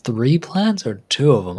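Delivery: ordinary delivery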